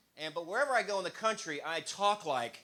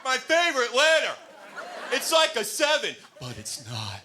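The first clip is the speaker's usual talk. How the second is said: high, loud, slow dopey shout; dim Wheel of Fortune contestant; 12 semitones higher, 8 dB louder, slower than usual. Retold or imitated. Comic mocking a Wheel of Fortune player, loud and dopey. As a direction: yell it out like a clueless contestant. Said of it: "Turn to Wheel of Fortune".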